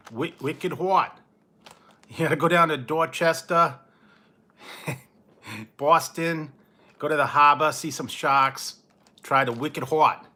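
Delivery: hammed-up Boston tour guide; mock Boston accent. Vlogger selling Boston to viewers in a mock Boston accent. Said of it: "You're Boston".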